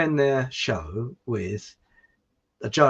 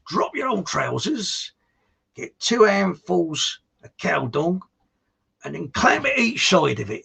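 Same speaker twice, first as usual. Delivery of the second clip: farm labourer; 5 semitones higher, slower than usual. Acted. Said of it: "the other farm labourers say".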